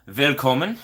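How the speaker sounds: put-on Willkommen in a German accent; German word. Welcoming a viewer with German Willkommen. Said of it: "as they say in Germany".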